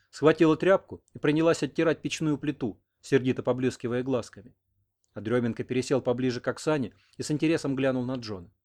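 ordinary delivery

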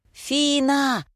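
протяжный зов высоким голосом; позови собаку протяжно, на высокой ноте. A narrator's reading. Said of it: "заорала я". (высокий протяжный окрик)

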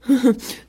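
short, high, refined chuckle; elegant laugh. A narrator's reading. Peacock shows off to the two girls, elegant laugh. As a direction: give a dainty, superior little laugh. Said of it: "Il eut un rire élégant".

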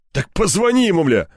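high, exasperated bark; barked order. A narrator's reading. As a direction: bark it at the boss, voice jumping up. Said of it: "рявкнул Кирпич". (раздражённо рявкнуть)

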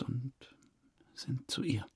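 embarrassed whisper. Acted. Suspect confessing to the detective, whispering in shame. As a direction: drop to a whisper, ashamed.

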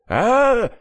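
high, loud scream of pain. A narrator's reading. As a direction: scream as the ear is cut. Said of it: "заорал апостол". (крик боли)

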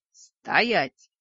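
loud commanding yell; shout. A narrator's reading. Heroine yells at the werewolf to stop, loud. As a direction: bark the halt at the werewolf. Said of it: "заорала я".

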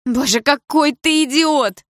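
mother screaming at the stepfather; cried out. A narrator's reading. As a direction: scream the insult at him. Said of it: "выкрикнула мама".

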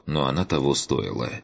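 ordinary talk

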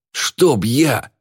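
plain reading voice

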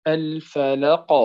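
الفلق said slowly with the final ق echoed and bounced; qalqala on final qaf. Shown as a demonstration. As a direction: stop on الفلق and let the ق rebound. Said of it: "واضح؟". (قلقلة كبرى على القاف في الفلق)

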